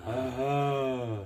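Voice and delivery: low relaxed ah. Shown as a demonstration. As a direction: let it fall easy. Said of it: "Now go, uh".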